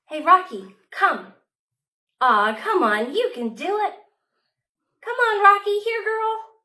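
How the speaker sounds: loud, high coaxing call; calling the dog. Acted. Child calling Rocky to come, loud coaxing. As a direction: call the dog across the yard.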